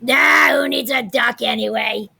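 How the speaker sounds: plain reading voice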